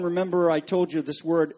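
ordinary talk, nothing marked

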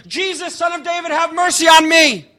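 high, sustained cry for mercy; Bartimaeus yelling. Acted. Blind man yelling to Jesus over the crowd, persistent yelling. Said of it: "And he just keeps yelling".

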